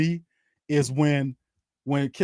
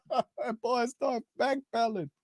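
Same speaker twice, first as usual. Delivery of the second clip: laughing; 8 semitones higher, 5 dB quieter than usual. Real, not acted. Still laughing at the play.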